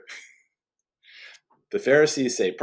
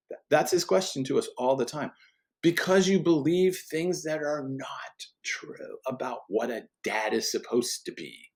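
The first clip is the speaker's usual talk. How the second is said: usual speaking voice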